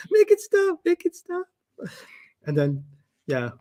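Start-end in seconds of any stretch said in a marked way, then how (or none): 0.1-1.4 high, whimpering make-it-stop plea; desperate plea. Retold or imitated. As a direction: beg for it to end, high and thin. Retold panic.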